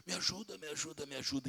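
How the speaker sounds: soft, fading cry for help; weak plea. Retold or imitated. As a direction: beg faintly like a bleeding man. Dying man begging for help.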